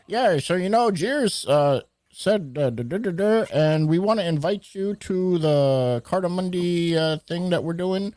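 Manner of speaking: high, chummy caller imitation; overfriendly caller. Caller invites the storyteller to an event, overfriendly high voice. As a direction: pitch it up, casual and familiar. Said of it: "talking to me like he's my best friend".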